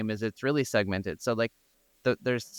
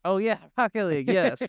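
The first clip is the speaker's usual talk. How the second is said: high, laughing oh yeah of sudden recall; laughing recognition; 8 semitones higher than usual. Real, not acted. Cohost remembers Rocket League, laughing. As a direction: laugh it out as the name clicks. Recalls the famous car game.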